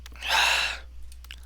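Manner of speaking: long breathy sigh; heavy sigh. Acted. Natsuki searching the closet, exasperated sigh. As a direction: let the air out in a sigh. Sigh while acting out the game.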